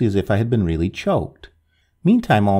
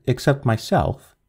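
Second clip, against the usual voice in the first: ordinary delivery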